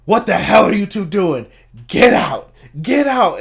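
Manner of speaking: loud, high mock-furious shout; Vince-style angry yell. Vince catching two wrestlers punching each other, retold angry yell. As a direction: yell it like a boss breaking up a brawl. Retelling Vince breaking up a fight.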